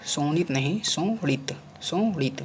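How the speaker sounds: ordinary talk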